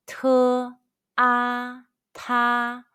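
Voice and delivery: "Te, a, ta" each held high and level; high-pitched first tone. Shown as a demonstration. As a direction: blend "Te" and "a" into "ta", sustained on one high note. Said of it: "the high-pitched first tone all together".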